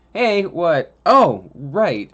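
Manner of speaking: mock startled reply to his sister. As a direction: caught off guard, then remembering. Startled reply, then remembering.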